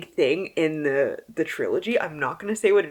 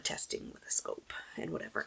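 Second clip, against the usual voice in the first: whispered fear; 17 dB quieter than usual. Real, not acted. Scary medical test.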